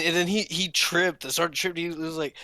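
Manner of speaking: usual speaking voice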